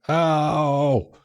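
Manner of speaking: sharp yelp of pain; a sudden hurt cry. Retold or imitated. Fingers sore from guitar strings.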